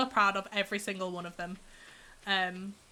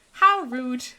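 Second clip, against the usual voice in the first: mock offended; 2 semitones higher, 10 dB louder than usual. Mock offence at missed catch.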